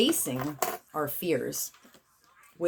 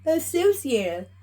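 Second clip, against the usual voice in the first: ritual incantation; 8 semitones higher than usual. Acted. Healer proclaims the clearing to the collective, raised invocation voice. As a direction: command the energy like a spell.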